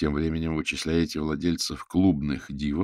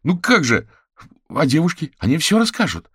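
high, loud, insistent objection; indignant protest; 12 semitones higher, 10 dB louder than usual. Acted. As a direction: argue back, pitch way up. Kuzya argues back at Anastasia.

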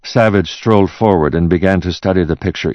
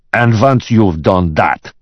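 slow, higher, forceful order; menacing boss; 5 semitones higher, 4 dB louder, slower than usual. Acted. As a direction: spell out the order angrily. Boss about to fire secretary.

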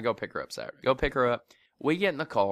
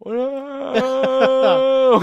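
startled whoa; 10 semitones higher, 9 dB louder than usual. Retold or imitated. Startled by a sneeze in the face.

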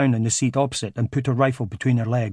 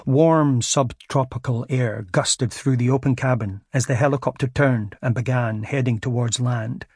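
ordinary delivery, nothing marked

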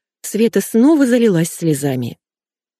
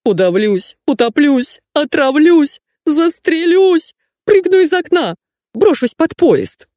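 climbing higher with each cry, building to a shriek; hysterical outcries; 10 semitones higher, 4 dB louder, slower than usual. A narrator's reading. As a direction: wail a melodramatic list of threats, ever higher. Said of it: "истерическими рыданиями и выкриками". (истерические выкрики по нарастающей)

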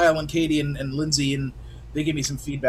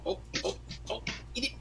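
playful cat voice; 10 dB quieter than usual. Retold or imitated. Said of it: "he's in a playful mood of".

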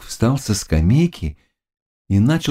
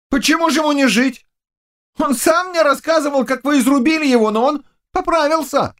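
high, brisk youthful voice; excitable young servant. Acted. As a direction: explain eagerly in a boyish pitch. (бойкий юный слуга высоким голосом)

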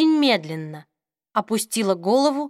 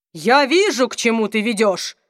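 through gritted teeth. A narrator's reading. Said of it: "процедил сквозь зубы Малахи".

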